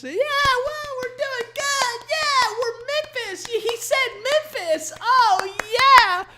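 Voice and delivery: very high, slow dopey falsetto; dumb crowd member. Retold or imitated. Mocking the crowd cheering Memphis, dopey falsetto. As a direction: squeak like a dim audience member. Crowd voiced in comedy bit.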